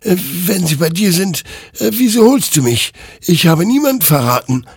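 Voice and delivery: high, fast, loud self-defence; panicked protest. Acted. Hector defends himself to the rider, frantic and high. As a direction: protest your innocence in a rush. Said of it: "wehrte sich Hector".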